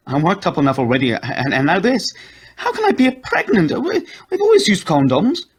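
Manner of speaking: a high voice near breaking in panic; panicked wife. A narrator's reading.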